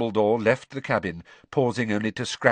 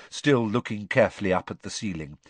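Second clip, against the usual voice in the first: plain reading voice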